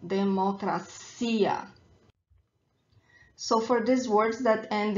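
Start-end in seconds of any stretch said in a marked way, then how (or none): 0.1-1.7 slow democracia, leaning on the ia; stress on final ia. Shown as a demonstration. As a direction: draw out democracia as de-mo-cra-CI-a. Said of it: "the stronger syllable is the last one".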